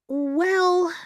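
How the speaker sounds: put-on drawn-out, high hesitant child; mildly reluctant opener, pitched high. A narrator's reading. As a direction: hesitate in a small girl's high voice.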